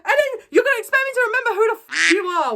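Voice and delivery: high, fast outraged rant; shrill-free high rapid telling-off; furious high rant. Retold or imitated. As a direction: fire it back, high and fast. Acted furious reply to Jeff.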